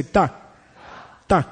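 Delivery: short, high-tone 'ta', cut off quickly. Shown as a demonstration. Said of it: "it means high tone".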